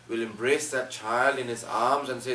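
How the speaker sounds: normal talking voice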